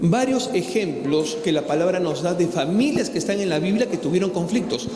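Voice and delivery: loud, driving sermon to the congregation; full-force preaching. Acted.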